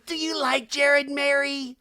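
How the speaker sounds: loud, high, drawn-out nosy question; prying busybody. Retold or imitated. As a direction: imitate a nosy woman calling it out. Acting Heather's prying question, loud take.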